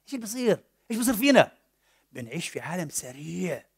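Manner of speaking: ordinary delivery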